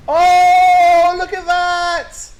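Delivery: high, loud and drawn-out caster yell; excited shout. Real, not acted. Caster hollers at Mel's big play, high and loud. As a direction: bellow it with delight as the play lands.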